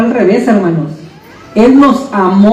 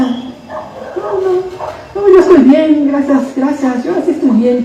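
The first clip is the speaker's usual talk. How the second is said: much higher, polite but brushing it off; dismissive refusal; 5 semitones higher, slower than usual. Acted. An unbeliever turning the preacher away, dismissive and high. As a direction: wave it away with a quick no thanks.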